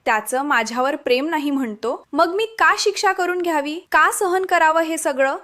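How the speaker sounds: ordinary delivery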